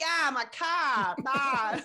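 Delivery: broad, drawn-out Boston vowels; Boston accent. Retold or imitated. Co-host mimicking an actor two scenes later, exaggerated Boston accent. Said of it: "John Krasinski accent".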